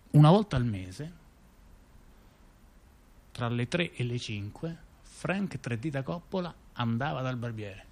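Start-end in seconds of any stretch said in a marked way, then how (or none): none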